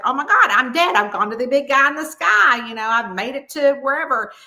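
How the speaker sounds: hammed-up "oh my God" reaction; mock astonishment. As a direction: play up the big realization. Voicing waking heroine who thinks she's dead.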